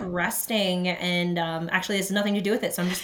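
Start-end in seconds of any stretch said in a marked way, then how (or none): none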